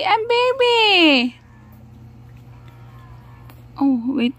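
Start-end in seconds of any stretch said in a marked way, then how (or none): 0.0-1.4 put-on baby voice. Mother voicing baby.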